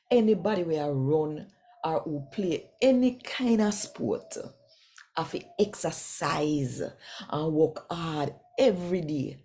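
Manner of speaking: normal talking voice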